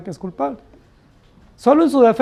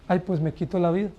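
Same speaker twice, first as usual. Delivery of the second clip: softer, sighing mock plea for pity; mock whiny threat; 4 semitones lower, 10 dB quieter than usual. Preacher imitating a blackmailer, softer sighing mock plea. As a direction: imitate someone guilting others, much softer.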